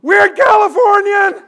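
very high, loud, drawn-out yell; freaked-out kid shouting. Retold or imitated. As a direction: yell it like a panicking child. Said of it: "you could see it on his face".

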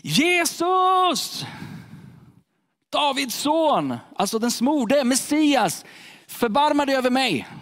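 high, desperate call to Jesus; crying out. Acted. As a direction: cry out from the roadside, pleading. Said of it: "hur han ropar".

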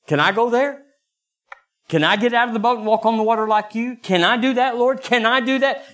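high, eager pleading; hopeful high-pitched plea; eager asking. Retold or imitated. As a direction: beg the Lord like an excited child. Pleading with God in prayer.

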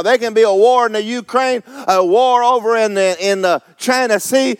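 high, fervent preacher's delivery; fired-up pulpit declaration; full-force preaching. Real, not acted. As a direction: preach it defiantly to the congregation.

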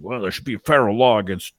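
lightly voiced grumbling man; mock the grumpy guy's complaint; grumpy passenger. Passenger grumbling about the courthouse, light mock voice. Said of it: "he's like".

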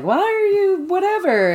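high, slow, nosy questioning voice; mock quizzing voice. Brother quizzing Rain, in a high nosy voice.

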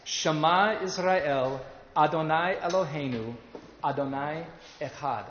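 "Shema Israel, Adonai Eloheinu, Adonai Echad" recited slowly, phrase by phrase; slow pronunciation demo. Shown as a demonstration. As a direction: sound out each Hebrew phrase slowly for learners. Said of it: "It sounds like this".

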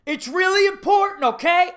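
high, loud coach shouting; overwrought coach. Retold or imitated. Coach pleading with little kids, overwrought shouting. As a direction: shout as if it were life or death. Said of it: "this guy's going".

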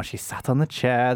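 usual speaking voice

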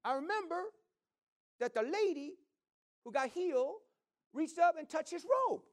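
imitating the woman. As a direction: lift into her high, earnest voice. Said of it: "But she said".

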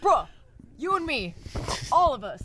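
frat bro. Pretend. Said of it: "that's the bit".

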